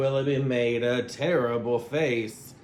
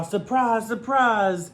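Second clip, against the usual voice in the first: lifted, higher, delighted call; surprise; 7 semitones higher than usual. A narrator's reading. Narrator springs the surprise, higher and delighted. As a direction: sing out the surprise.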